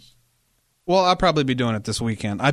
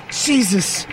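ordinary talk, nothing marked

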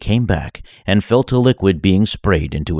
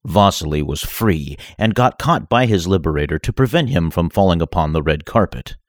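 ordinary delivery, nothing marked

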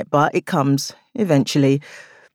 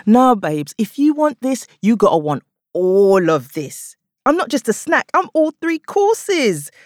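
flirty stage tease; 6 semitones higher than usual. Acted. Comic to quivering man in front row, cheeky tease. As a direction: sell it to the skinny guy.

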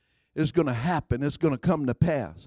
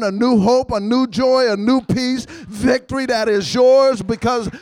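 rising fervent preacher cadence, louder and higher; preaching at full force; 5 semitones higher, 5 dB louder than usual. Acted. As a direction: build the praise like a revival preacher. Preaching builds.

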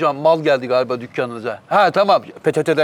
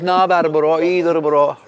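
lightly voiced Americanized kid saying bro; tossing off bro like a wannabe American; mock Americanized youth. Mocking young people who greet each other with bro, lightly voiced. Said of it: "sanki Amerikalı bunlar".

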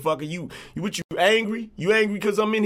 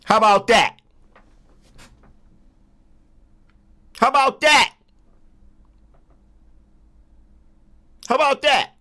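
loud, higher, repeated jeering question; loud taunt; 5 semitones higher, 5 dB louder than usual. Real, not acted. Streamer taunting a troll he just exposed, loud gloating jeer. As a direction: throw it at them again and again, gloating.

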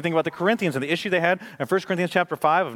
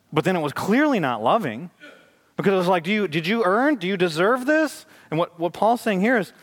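higher, louder rising sermon voice; impassioned preaching; 5 semitones higher, 3 dB louder than usual. Acted. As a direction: preach it with building fire. Preacher builds to full force.

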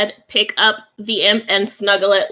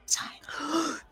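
whispered; 6 semitones higher, 11 dB quieter than usual. Acted. Dramatic pause before last word.

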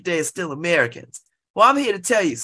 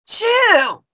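chill jumps up high and louder, drawn out; high exasperated chill; 6 dB louder than usual. Real, not acted. Vlogger tells Iranian team to chill, exasperated. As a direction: plead with them to calm down. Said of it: "listen, Iranians".

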